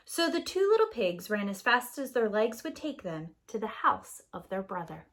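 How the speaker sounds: usual speaking voice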